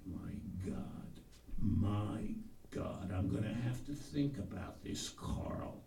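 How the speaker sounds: low, hushed and downcast; deflated, almost under the breath; depressed. Acted. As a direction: sink into a quiet, stunned gloom. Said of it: "I was some depressed".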